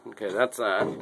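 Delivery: ordinary delivery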